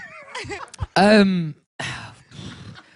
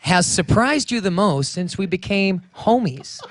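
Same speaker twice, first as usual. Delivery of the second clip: put-on slow, drawn-out American accent; slower than usual. Interviewer asks his homie a question, American accent. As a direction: lay the American on thick, word by word. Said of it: "Your American accent right now".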